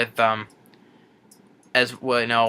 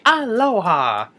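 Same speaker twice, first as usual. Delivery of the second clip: high, slow cheery alien greeting; high drawn-out creature voice; Stitch voice; 10 semitones higher, slower than usual. Retold or imitated. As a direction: sing out a mock-friendly hello.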